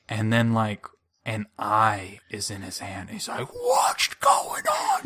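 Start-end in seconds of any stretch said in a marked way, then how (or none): 3.5-5.0 panicked yell. Retold or imitated. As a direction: scream it in terror. Panicked character yelling.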